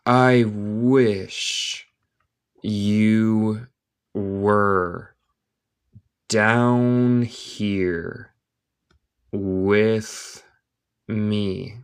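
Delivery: very slow, long gaps between words; word-by-word dictation. Shown as a demonstration. Teacher reading Alice to Spanish-speaking learners, word by word. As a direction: draw out each word for learners.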